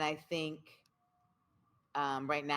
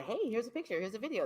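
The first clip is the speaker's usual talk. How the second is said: bright mock sharing; 9 semitones higher, much faster than usual. Imitates partner sharing.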